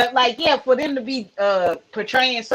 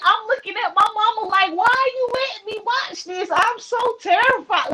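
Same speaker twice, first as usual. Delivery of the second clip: high mock terrified plea; 12 semitones higher than usual. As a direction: whine it up high, scared. Retold self talking to mama.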